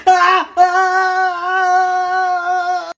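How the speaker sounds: very high, long drawn-out wail; nightmare scream. Acted. Boy screaming in his sleep, high and drawn out. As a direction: wail in terror.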